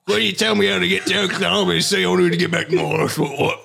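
high, garbled mock Cockney; Benedict Cumberbatch. Asking at the trolley for directions, frantic fake Cockney. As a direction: babble it in hammed-up Cockney. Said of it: "He's gone full Cockney".

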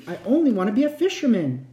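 plain reading voice

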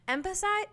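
"Emphasize" tried out with a high questioning lift; word checked aloud. Shown as a demonstration. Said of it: "Emphasize and empathize are almost the same word".

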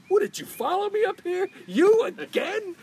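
high, loud comic disbelief; incredulous exasperation. Retold or imitated. Ballplayer finds his heckler in another city, loud incredulous exasperation. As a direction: build to a drawn-out, high outburst.

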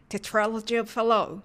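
slow Tetralogy of Fallot, American A in "tra"; English term demo. Shown as a demonstration. Teacher models heart term before native clip, slow careful Tetralogy of Fallot. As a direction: model Tetralogy of Fallot slowly, silent final T. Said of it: "と発音します".

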